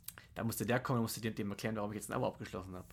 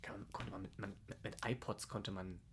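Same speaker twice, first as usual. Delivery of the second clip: whispered aside; 4 semitones lower, 10 dB quieter than usual. Real, not acted. Mumbled doubtful correction.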